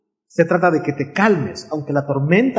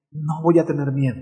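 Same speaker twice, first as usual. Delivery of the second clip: normal talking voice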